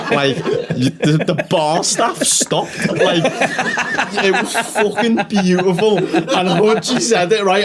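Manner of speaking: high-pitched, helpless laughter through the words; laughing. Real, not acted. Comic telling co-host about the silent room, cracking up. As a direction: struggle to get the story out for laughing. Cracking up retelling the joke's death.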